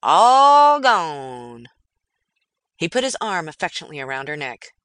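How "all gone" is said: put-on loud drawn-out old-timer lament; old prospector. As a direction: wail it like a weathered miner. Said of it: "he said to the burro".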